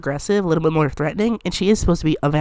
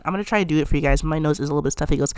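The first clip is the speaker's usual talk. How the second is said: ordinary delivery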